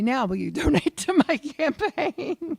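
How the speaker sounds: high, laughing sales pitch; mock donation plea. Candidate sweet-talking a voter on the phone, mock pleading. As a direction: sing out the ask through a laugh.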